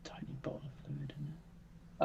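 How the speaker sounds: hushed whisper to yourself. Real, not acted. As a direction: whisper it under your breath. Quietly noting item weight aside.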